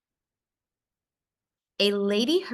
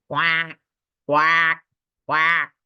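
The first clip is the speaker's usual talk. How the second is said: big duck quack; 6 dB louder, much slower than usual. A narrator's reading. Reading to a child, quacking like the wary big ducks. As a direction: give each quack the weight of a big duck. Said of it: "The big ducks say".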